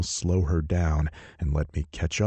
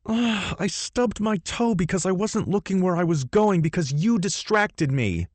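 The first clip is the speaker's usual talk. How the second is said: higher, whiny woman's voice; pained heroine; 14 semitones higher than usual. Acted. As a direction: complain through the pain. Heroine in pain, complaining.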